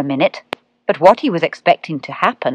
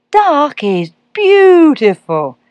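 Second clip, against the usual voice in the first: dreamy awe; 10 semitones higher, 6 dB louder, slower than usual. A narrator's reading. Cat enchanting the owlet.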